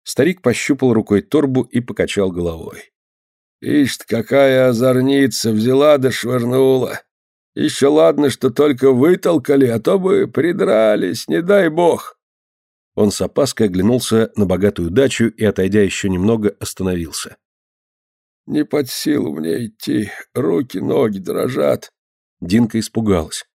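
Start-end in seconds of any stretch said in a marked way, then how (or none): 3.6-12.2 put-on slow, high old man's lament; drawn-out elder's worried grumble; fretting old man. A narrator's reading. Old man after being chased out, fretting. 18.5-21.9 put-on slow, feeble old man's voice; exhausted old man. A narrator's reading. Old man to girl, too weak to walk, exhausted.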